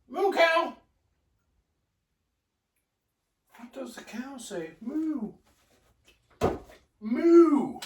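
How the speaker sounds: put-on high singsong baby talk; reading to a toddler. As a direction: talk like to a toddler about cows. Adding milk, baby talk.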